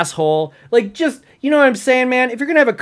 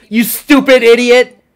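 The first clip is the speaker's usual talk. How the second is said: loud, high exasperated shout; angry yell; 7 semitones higher, 8 dB louder than usual. Real, not acted. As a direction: hurl the insult at the screen. Insulting the video's speaker.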